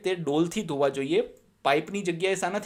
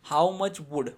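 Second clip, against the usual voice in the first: slow explaining reread; much slower than usual. Shown as a demonstration. Teacher rereads the line to explain it, slow. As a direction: go back over it word by word. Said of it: "ચકનો earth".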